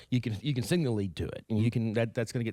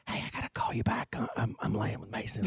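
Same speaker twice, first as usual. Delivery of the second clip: put-on hushed whisper beside a sleeping kid; 5 dB quieter than usual. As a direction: whisper so the baby stays asleep. Dad hushed beside sleeping son.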